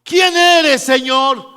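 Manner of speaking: high, loud cry to the Lord; loud cried question. Acted. Paul crying out to the unknown Lord, loud high question. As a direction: call it out high and forcefully. Acts out Paul's startled question to Jesus.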